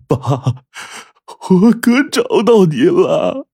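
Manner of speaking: high, drawn-out emotional cry; overwhelmed cry to a long-lost father; uncontrolled emotion. A narrator's reading. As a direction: blurt it out, overcome, stretching the words. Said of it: "再也控制不住自己的情感了".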